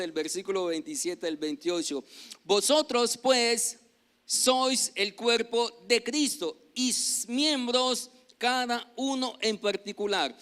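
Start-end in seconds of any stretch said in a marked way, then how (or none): 2.4-10.4 loud, higher, slow declamation; scripture read aloud. Real, not acted. Pastor reading scripture to the congregation, loud slow proclamation. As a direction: proclaim the verse, drawing the words out.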